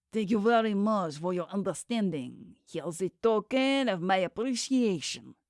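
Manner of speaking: put-on a smooth French accent on every word; thick French accent. A narrator's reading. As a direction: speak English like a polite Frenchman. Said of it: "The voice had a thick French accent".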